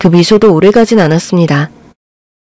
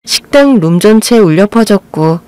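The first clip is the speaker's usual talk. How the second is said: usual speaking voice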